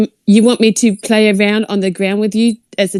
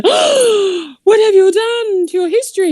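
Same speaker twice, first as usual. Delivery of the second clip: gasp, then high mock outcry; horrified parents; 11 semitones higher than usual. Parents seeing chopped pigtails, hammed-up horrified cry. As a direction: act a scandalised parent, pitched high. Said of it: "your native Indian parents go".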